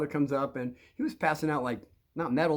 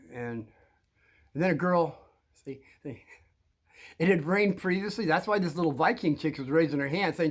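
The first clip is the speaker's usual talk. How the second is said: normal talking voice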